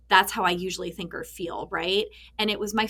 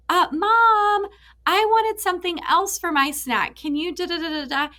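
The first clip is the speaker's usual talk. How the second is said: put-on high, louder little-kid voice, whining for a snack; imitating her young son; 10 semitones higher, 4 dB louder than usual. Son interrupts busy mom about his snack, kid voice. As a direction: play the son asking Mom for something else. Mother retells her son's request.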